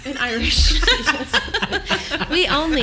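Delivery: loud, high mock Irish brogue, laughing; laughing mock Irish accent; hammed-up Irish accent. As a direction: ham up an Irish lilt for fun. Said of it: "My roots are showing".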